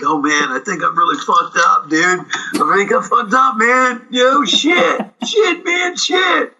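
put-on high, slurring drunk with rising yells; a wasted kid losing it; drunk Bill. Bill drunk at the picnic table, yelling high and wasted. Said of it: "Bill's like".

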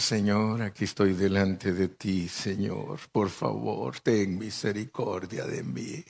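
soft, humble plea to God; quiet prayer. Shown as a demonstration. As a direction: pray softly, no need to shout. Models prayer without any shouting.

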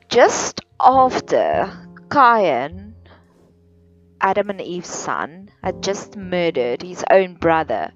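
usual speaking voice

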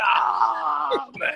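mock anguish. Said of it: "I said".

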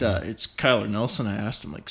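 ordinary talk

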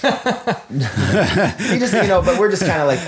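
laughing through the words, high; amused, giggling aside. Real, not acted. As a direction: breaks into laughter mid-sentence. Laughing while telling the story.

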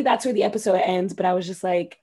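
normal talking voice

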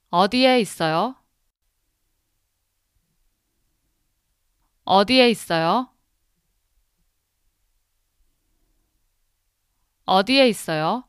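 quick, fluent repetition. Shown as a demonstration. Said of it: "Now faster".

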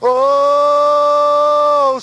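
long sustained prayerful oh; drawn-out worship moan. Real, not acted.

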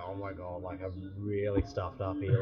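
soft, scared inner voice; scared and hushed. Retold or imitated. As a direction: say it quietly, frightened. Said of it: "I was terrified. I was like".

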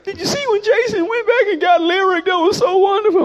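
high, loud, gushing fan voice; gushing moviegoer. Retold or imitated. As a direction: rave about the movie like a giddy fan.

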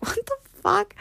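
high, softened mock disbelief; stunned disbelief. Daughter reacting to dad's prank, stunned. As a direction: squeak out your astonishment.

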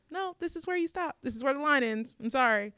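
firm boundary-setting. Retold or imitated.

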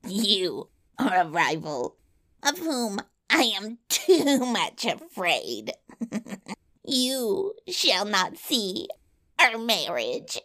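slow, swooping villain voice with cackles; wicked dwarf gloating over a rival; mocking yellow dwarf. A narrator's reading. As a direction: taunt slowly, cackling between phrases. Yellow dwarf taunts his rival.

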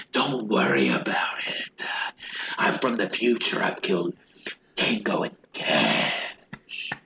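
hushed, breathy Palance whisper; breathless whisper. Retold or imitated. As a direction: whisper it like a secret threat. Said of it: "Jack Palance is doing that breathless".

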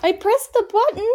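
high-pitched insistent protest; defensive protest. Real, not acted. Arguing with her friend about the mute button, high insistent protest. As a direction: insist you did it, voice up high.

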